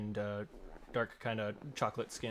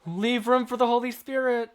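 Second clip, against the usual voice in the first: mock church chaperone; 12 semitones higher, 10 dB louder than usual. Teasing church chaperone line.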